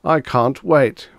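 distressed, drawn-out cry; wailing. A narrator's reading. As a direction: drag out the despair. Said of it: "wailed Mister Demise".

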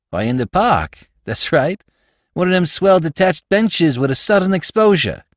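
New York slang, pleased with himself; Spike, slangy New York crook. Acted. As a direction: play a cheeky small-time crook.

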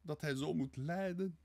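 high-pitched wail of pity; lamenting. A narrator's reading. Sonja grieves at his suffering, lamenting. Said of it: "Sonja's weeklacht ging gepaard".

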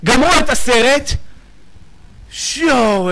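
ordinary talk

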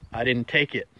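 usual speaking voice

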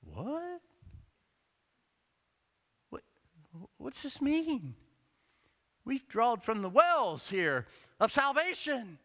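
high, loud, puzzled what and what's this mean; confused protest from the crowd; bewildered crowd. Retold or imitated. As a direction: crowd asking what it means. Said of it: "everybody's going".

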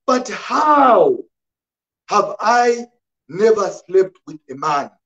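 high, declaimed Bible reading in measured phrases; emphatic pulpit reading; preacher's scripture reading. Real, not acted. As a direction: proclaim the story to a congregation, pausing between phrases. Reads scripture aloud to congregation.

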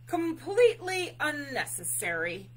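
high, loud bear pronouncement; grand announcement. A narrator's reading. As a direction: announce it emphatically as a dismissive bear. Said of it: "Bear announced".

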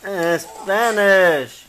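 slow, stretched-out correction; drawn-out, playfully exasperated reminder; exasperated prompting. Real, not acted. Child answered in English again.

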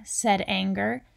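plain reading voice

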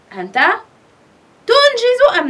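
tنجز lifted high in a questioning lilt; contrast the wrong form. Shown as a demonstration. Teacher asking pupils about the wrong verb form, stressing تنجز. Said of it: "وليس أنت تنجز التمارين".

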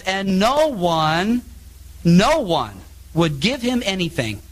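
very slow, stretched no one; slow scripture emphasis. Real, not acted. Preacher reading Luke to congregation, slow. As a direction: weigh each word of the verse. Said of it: "verse sixteen it says".

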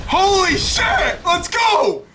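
high, slow yell of pure hype; real shout of delight at the reveal; excited yell. Real, not acted. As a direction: gamer yelling with joy at the trailer. Reveal starts, yells.

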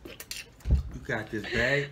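slow, hushed whisper of support; encouraging whisper. Real, not acted. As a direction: whisper her through it. Coaching partner through the bite.

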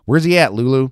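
loud call across the house; shouting. Acted. Betty calling through the house, loud shout. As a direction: call out loud and high for Lulu.

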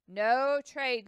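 loud, final no; firm refusal. A narrator's reading. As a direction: slam the door on it. Lewis repeats refusal.

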